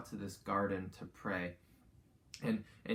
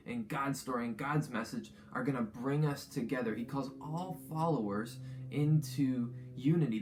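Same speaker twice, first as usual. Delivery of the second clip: rising fervent preaching, higher and louder; upbeat youth pastor; 4 semitones higher, 4 dB louder than usual. Acted. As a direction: build energy as you preach unity. Sermon builds to fervent preaching.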